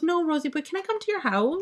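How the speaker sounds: put-on high, sweet little girl pleading; cute child voice. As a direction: coax Grandma like a small child. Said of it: "And she goes".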